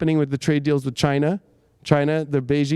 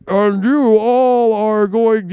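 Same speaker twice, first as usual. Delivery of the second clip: slow, loud, menacing villain voice through a mask; Bane impression; 4 semitones higher, 4 dB louder, much slower than usual. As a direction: drag it out like Bane in Batman. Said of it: "you know how he's like".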